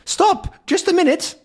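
very high, loud stop; urgent call. Acted. Calculus rushing up to stop Tintin, urgent. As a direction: call out to halt him. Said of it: "says Calculus".

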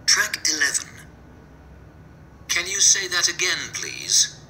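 ordinary talk, nothing marked

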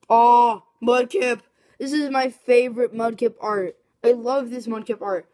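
excited. Real, not acted. Excited pulling favorite card.

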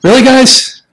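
high, loud, fed-up really; exasperated. Real, not acted. Interrupted while recording, loud high exasperated protest. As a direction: call out the interruption in disbelief.

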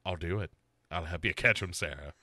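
low, quiet sinister killer. Retold or imitated. Bo as the killer agreeing, low and quiet. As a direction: murmur it very low and creepy. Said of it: "And he's like".

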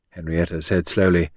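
usual speaking voice

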